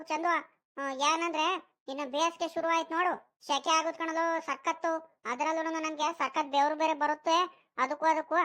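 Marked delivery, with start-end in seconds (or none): none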